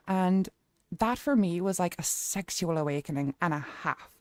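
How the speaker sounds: animated Irish storytelling; Irish accent. Pretend. Streamer recounting a bad relationship to chat, lively Irish accent. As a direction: rant in a put-on Irish accent. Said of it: "Let's go Irish".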